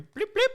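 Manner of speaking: high beeping Nextel chirp; imitated phone chirp. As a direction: mimic the walkie-talkie beep. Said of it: "You hear".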